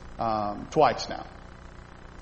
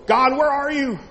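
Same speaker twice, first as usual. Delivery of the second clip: high, loud anguished plea; desperate cry to God; 12 semitones higher, 6 dB louder than usual. Retold or imitated. Believer in trouble calling out to God, high loud plea.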